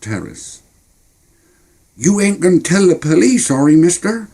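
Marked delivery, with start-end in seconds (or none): 2.0-4.3 put-on high anxious kid voice begging; a boy pleading not to be told on. Boy pleads with the Texan, high and worried. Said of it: "I pleaded with the Texan".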